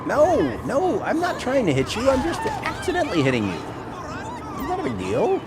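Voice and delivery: high mock apology. Apologizing to someone being hit.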